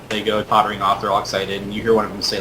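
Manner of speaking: usual speaking voice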